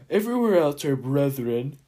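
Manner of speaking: high put-on mosquito voice; loud, hammed-up insect voice. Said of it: "I gotta get my mosquito voice".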